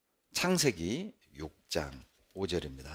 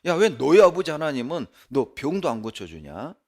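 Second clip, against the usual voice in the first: higher, loud jeering taunts, building; sneering taunts; 6 semitones higher, 4 dB louder than usual. Retold or imitated. Preacher voicing a neighbour mocking a believer's troubles, sneering loud. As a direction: jab at him with mocking questions. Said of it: "비꼬는 거예요".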